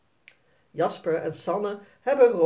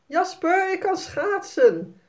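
hoge, luide kinderroep; schreeuwen; 12 semitones higher, 4 dB louder than usual. A narrator's reading. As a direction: schreeuw trots naar een vriendje. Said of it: "schreeuwt Sanne". (meisje schreeuwt trots naar Jasper)

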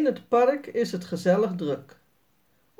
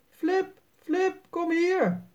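higher voice calling out to a dog; 10 semitones higher, slower than usual. A narrator's reading. The owner calls his little dog from afar, higher. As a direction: call the runaway puppy back. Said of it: "horen ze roepen".

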